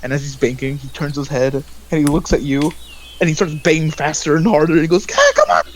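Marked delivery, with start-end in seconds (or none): 5.1-5.6 very high, loud yell; desperate yelling. Acted. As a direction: yell out while banging on a locked door. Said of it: "yelling out".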